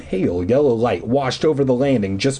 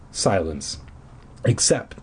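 plain reading voice

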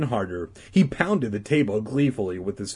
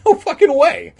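much higher, loud gleeful refusal; merry refusal; 12 dB louder than usual. Acted. Beth refusing Todd gleefully, high and loud. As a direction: refuse with glee. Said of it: "glancing merrily".

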